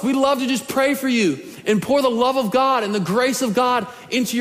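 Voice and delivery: preaching at full force. Acted. Pastor urging the church to come for prayer, full-force preaching. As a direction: urge them down front, full preacher power.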